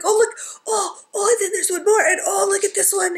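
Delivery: put-on high-pitched kid squealing over presents; excited little kid. Retelling how she opened her mail for her husband, excited kid voice. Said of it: "like a little kid at Christmas".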